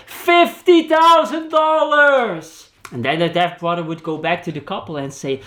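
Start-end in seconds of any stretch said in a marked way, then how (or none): 0.1-2.7 high, slow shout, punching fifty; shouted correction. Retold or imitated. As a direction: bellow the right price back, insisting. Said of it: "says the brother".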